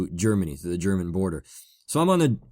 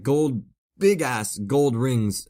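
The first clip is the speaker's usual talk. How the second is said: normal talking voice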